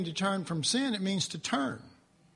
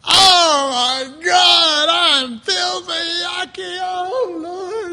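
anguished cry; 9 semitones higher, 11 dB louder, much slower than usual. Retold or imitated. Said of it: "retold despair after dreams".

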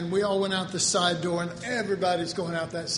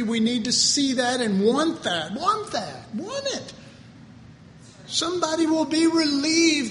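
raised pitch, emphatic pulpit plea; impassioned preaching; 6 semitones higher than usual. Real, not acted. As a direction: preach it urgently, stretching the key words. Preaching climax, urging the congregation.

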